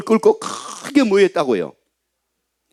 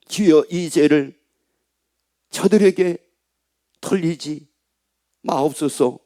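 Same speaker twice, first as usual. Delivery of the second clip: slow, lower, softening solemn reading; scripture reading; 3 semitones lower, slower than usual. Acted. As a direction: read the prayer slowly, fading. Reads the prayer again. (천천히 낭독하는 기도문)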